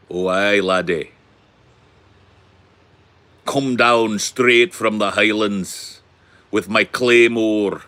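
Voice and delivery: hearty, drawn-out Scottish Highlander; put-on Scottish accent. Shown as a demonstration. Streamer showing that Scottish is better, hammed-up Scottish accent. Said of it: "Scottish is way better".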